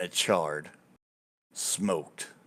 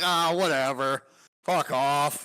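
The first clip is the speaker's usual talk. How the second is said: dismissive; 6 semitones higher, 3 dB louder than usual. Acted. Storyteller brushes off hecklers.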